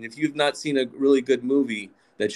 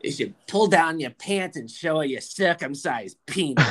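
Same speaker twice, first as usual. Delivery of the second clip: put-on higher-pitched, emphatic New York accent; 5 semitones higher than usual. Mom advising her son, brazen New York accent. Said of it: "And she goes".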